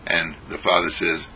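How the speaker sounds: ordinary delivery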